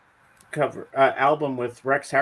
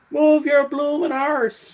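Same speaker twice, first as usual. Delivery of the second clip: put-on high, slow Cockney accent shout; shrill Cockney accent outburst; 13 semitones higher, 4 dB louder, slower than usual. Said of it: "talking in her Cockney accent".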